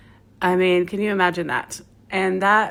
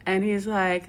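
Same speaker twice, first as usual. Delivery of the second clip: normal talking voice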